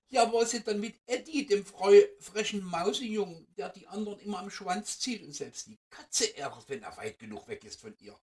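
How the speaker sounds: höher, lauter und schneller, ganz eifrig; aufgeregtes Mäusekind. Acted. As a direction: wie ein Kind, das nicht abwarten kann. Mouse child asks eagerly. (Mäusekind fragt die Fee nach Eddie, hoch und aufgeregt)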